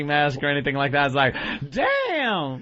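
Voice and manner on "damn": long, drawn-out "damn"; oblivious bystander. Acted. Oblivious bystander eyeing the killer's mask, drawn-out awe. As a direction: stretch it slowly in mock admiration. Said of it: "not paying attention to the fact".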